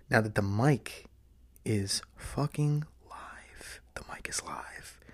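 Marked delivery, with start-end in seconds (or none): 1.6-5.0 slow, fading breathy whisper. Shown as a demonstration. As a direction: drop to a hushed whisper. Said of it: "I can whisper now".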